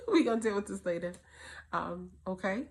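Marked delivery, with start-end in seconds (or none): 0.1-1.1 fast line broken by laughter, louder; laughing. Retold or imitated. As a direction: laugh it off with the child. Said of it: "and say".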